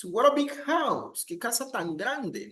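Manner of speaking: very high, swooping wonder; exaggerated admiration. Shown as a demonstration. Teacher translates admiring phrase for students, exaggerated amazement. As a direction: exclaim as if amazed, pitch sailing up and dropping. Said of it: "I am exaggerating something".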